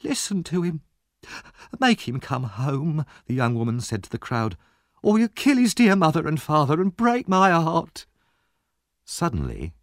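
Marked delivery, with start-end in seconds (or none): 0.0-3.1 high plea, slowing into a wail; tearful appeal. A narrator's reading. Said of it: "She burst into tears". 5.0-8.1 high, distraught wail; tearful appeal. A narrator's reading. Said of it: "She burst into tears".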